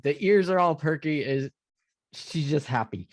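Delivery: ordinary talk, nothing marked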